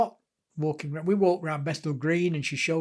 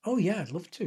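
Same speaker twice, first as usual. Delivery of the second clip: retold eager acceptance; 2 semitones higher than usual. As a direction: say yes to the invitation, mildly keen. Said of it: "And I went".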